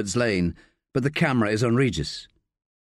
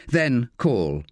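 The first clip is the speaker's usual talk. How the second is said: plain reading voice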